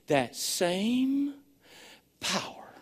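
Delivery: soft, low, awed sermon voice; a hushed preacher dwelling on Christ's power; hushed preaching. Acted. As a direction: bring them in close, quietly.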